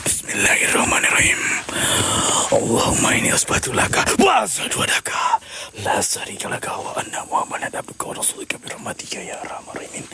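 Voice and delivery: fast hushed prayer; whispered prayer. Real, not acted. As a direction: rush the prayer under your breath.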